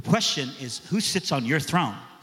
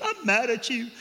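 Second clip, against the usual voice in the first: put-on lightly raised girlish voice; young girl voice; 7 semitones higher than usual. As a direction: voice the upset teen girl, a little higher. Preacher voices young woman's complaint.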